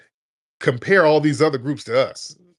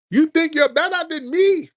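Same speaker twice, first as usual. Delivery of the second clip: high, loud whiny accusation; squealing mock outrage; mock accuser; 5 dB louder than usual. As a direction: parody a resentful challenger. Mocking a resentful accuser.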